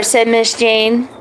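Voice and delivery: ordinary delivery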